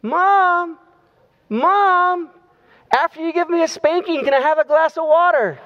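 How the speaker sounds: put-on high small boy's voice calling out from bed; little boy calling. Little boy calling his mother from bed, high childlike voice. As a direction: pitch way up like a kid calling his mom. Boy asks after spanking threat.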